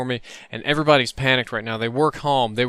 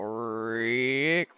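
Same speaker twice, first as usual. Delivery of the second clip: drawn-out vocal creak; creaking handle noise; 7 dB quieter than usual. Retold or imitated. Storyteller turning an old door handle, creaking noise.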